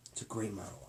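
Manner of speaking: usual speaking voice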